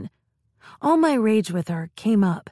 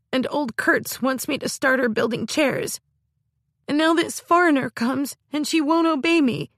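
sobbing; 5 semitones higher than usual. Acted. Girl pours out her grievances to her sister, sobbing. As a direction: pour it out, crying and complaining. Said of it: "started to sob".

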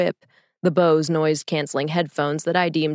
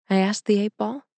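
ordinary delivery

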